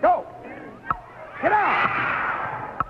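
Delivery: high, breathy cry to move; host yelling a contestant up from his chair; excited yell. Real, not acted. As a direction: urge him on with a sharp yell. Host urging contestant during timed game.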